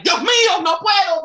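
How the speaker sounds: high loud shriek of shock; shocked grandma. Retold or imitated. Grandma finds grandson sleeping naked, shrieking. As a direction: shriek as if scandalised.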